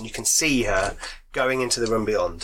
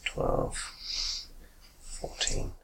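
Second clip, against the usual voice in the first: whispered under the breath; whispering; 10 dB quieter, much slower than usual. Real, not acted. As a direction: breathe the numbers out. Repeating the rolls unvoiced.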